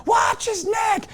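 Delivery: high, drawn-out mock shriek; imitated screaming wife. Wife warning the new father, imitated high scream. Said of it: "I'm hearing my wife screaming".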